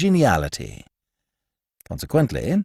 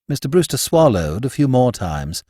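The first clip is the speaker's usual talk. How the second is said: plain reading voice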